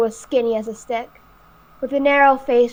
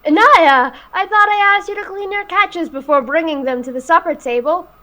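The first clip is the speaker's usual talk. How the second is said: teasing father; 6 semitones higher, 5 dB louder than usual. Acted. Father teasing his daughter.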